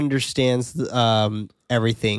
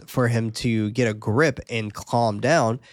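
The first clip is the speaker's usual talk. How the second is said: usual speaking voice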